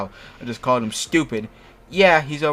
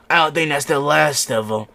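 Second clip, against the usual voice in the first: loud, higher-pitched villain jeer; devilish taunt; 4 semitones higher, 7 dB louder than usual. A narrator's reading. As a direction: call it out with devilish relish. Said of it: "he said, grinning a devilish grin".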